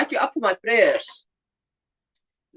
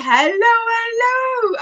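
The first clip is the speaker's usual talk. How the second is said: excited greeting; 11 semitones higher, slower than usual. Real, not acted. Greeting a waving viewer.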